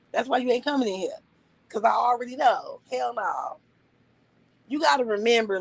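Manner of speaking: usual speaking voice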